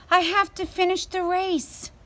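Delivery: high, urgent worried voice; panicked. Acted. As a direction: rush it out in alarm. Awoke with a start.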